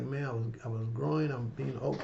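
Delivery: ordinary talk, nothing marked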